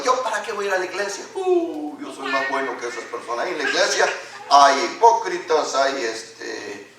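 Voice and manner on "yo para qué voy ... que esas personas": fast, softer dismissive mimicry; scornful excuse. Pastor imitates people who skip church, lightly scornful. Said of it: "personas que he oído yo".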